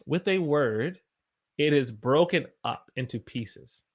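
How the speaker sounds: normal talking voice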